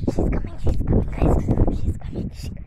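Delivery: loud unvoiced whisper; thrilled whisper as the dog comes; breathless excited whisper; excited stage whisper. Real, not acted.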